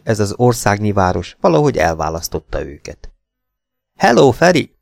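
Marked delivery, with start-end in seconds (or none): 4.0-4.7 high, loud hail to a friend; called-out greeting. A narrator's reading. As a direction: call out brightly across the stairs. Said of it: "kiáltotta Szirmai".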